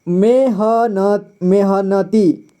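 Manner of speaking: "मेहनती" drawn out slowly, each syllable apart. Shown as a demonstration. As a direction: sound out "मेहनती" very slowly with a full न. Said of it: "न में भी पूरा sound आ रहा है".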